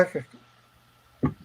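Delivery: soft, slow repeating echo; fading echo. Shown as a demonstration. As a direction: let the word echo away, fading out. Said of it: "It's like echo".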